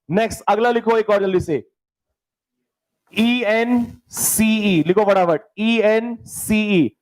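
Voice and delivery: normal talking voice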